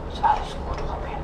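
whispering. Real, not acted. Nurse soothing a frightened child.

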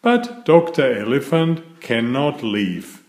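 plain reading voice

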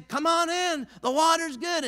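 calling out. Retold or imitated. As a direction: call a friend into the water. Swimmer calling from the water.

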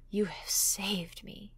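slow, whispered thanks; grateful whisper. A narrator's reading. McFadden saying farewell to the narrator, whispered. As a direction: breathe it out in relief. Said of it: "he said".

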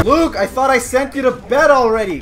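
high, loud exasperated parent; yell, then scold in mock exasperation. Vader sending son Luke to bed, mock scolding. Plays scolding father in game.

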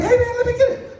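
very high breathy falsetto, quick; squeaky high pop-star voice begging. Retold or imitated. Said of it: "Taking my [name] voice right now".

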